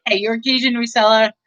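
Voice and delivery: usual speaking voice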